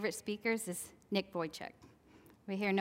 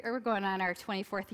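ordinary delivery

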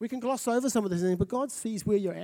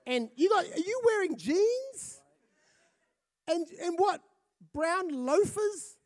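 higher, slower, scolding bride voice; mock upset bride; 6 semitones higher than usual. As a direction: play the bride let down by the groom's outfit. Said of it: "this is what Shelley says".

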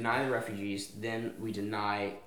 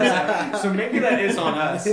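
high, loud words pushed out through laughter; laughing at the podcast's own fault; 9 semitones higher, 11 dB louder than usual. Real, not acted. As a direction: giggle your way through it.

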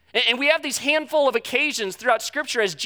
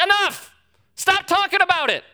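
loud, high burst of exasperation; fed-up shout; 10 semitones higher, 4 dB louder than usual. Retold or imitated. Parent at the tenth day, shouting at the teen to stop. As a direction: snap at the kid to drop it. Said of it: "you're like".

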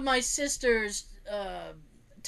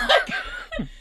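laughing; 4 dB louder than usual. Real, not acted. Laughing at mom's eagerness.

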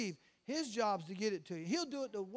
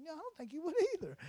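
quick, higher, teasing reply; playful agreement; 7 semitones higher, faster than usual. Retold or imitated. Me agreeing with my wife, playfully. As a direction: toss it back with a grin.

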